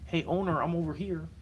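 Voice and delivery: high, silly voice of a dog answering; pretend dog voice. Owner asks dog questions, dog answers in a high silly voice. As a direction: play the dog calling its owner, high and goofy. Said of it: "like it's gonna say".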